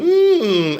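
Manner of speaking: high creature whimper; Skeksis. Pretend. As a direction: hum a high, put-on whine. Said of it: "I'm an adult male with painted fingernails going".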